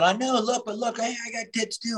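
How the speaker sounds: high, squeaky plea to the crowd; a hammed-up squeal to win them over; mock pleading. Comic begging the booing strip club crowd, in a high squeaky voice.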